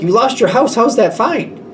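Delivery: high-pitched mock disbelief; incredulous. Worried friend to the one who lost their house, incredulous. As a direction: challenge them in astonished protest.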